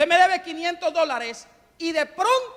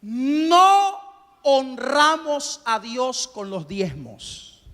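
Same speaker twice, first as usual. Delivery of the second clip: slow and deliberate; 3 dB louder, much slower than usual. Shown as a demonstration. Preacher spelling out point four to the congregation, very slowly. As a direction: stretch out every word slowly, NO held long. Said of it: "se lo voy a decir despacito".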